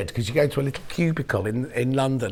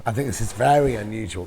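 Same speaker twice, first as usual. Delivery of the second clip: normal talking voice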